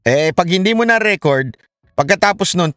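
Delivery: ordinary talk, nothing marked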